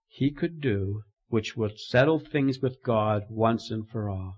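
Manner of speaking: ordinary talk, nothing marked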